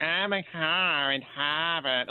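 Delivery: mock TV-cop Boston accent, drawn out slowly; bad Boston accent. Irked fan mocking TV cops' accents, bad Boston accent. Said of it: "and the cop is like".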